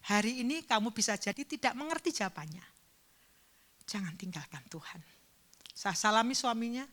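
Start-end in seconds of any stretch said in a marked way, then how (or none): 3.8-5.0 soft unvoiced whisper. Shown as a demonstration. Pastor pleading with the grieving mother, hushed whisper. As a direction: whisper it close, like a secret plea.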